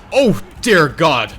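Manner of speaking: alarmed yell, slowed at first; loud panicked outburst at troops; shouting orders over battle. Real, not acted. Battle going wrong, yelling.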